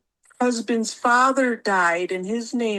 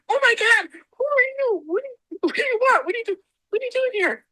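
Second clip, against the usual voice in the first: high, frantic yelling at an intruder; retold panic, pitched very high and fast; frightened yelling; 12 semitones higher than usual. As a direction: demand who he is, scared. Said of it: "I was yelling at him".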